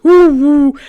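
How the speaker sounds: loud, high hooting bird; big bird's booming woo; bird call. Acted. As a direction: hoot it out like a giant bird. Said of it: "it just sort of goes".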